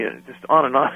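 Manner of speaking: ordinary delivery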